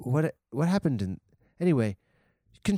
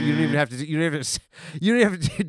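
ordinary talk, nothing marked

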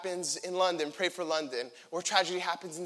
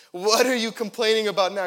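irritated retort; 9 dB louder than usual. Acted. David retorts to angry brother.

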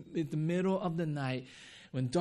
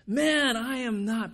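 raised, higher protest; Peter's loud denial; 7 semitones higher, 7 dB louder, slower than usual. Acted. Peter denying the bystander, raised voice. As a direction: push the denial back hard. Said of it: "Peter said".

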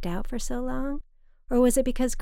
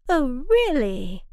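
mock surprise; 6 semitones higher, 5 dB louder, slower than usual. A narrator's reading. Mother's mock interest.

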